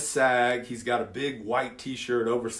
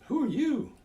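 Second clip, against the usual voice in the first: much higher, astonished question; can't believe who's standing there; amazed; 12 semitones higher than usual. Retold or imitated. Sees transformed kid.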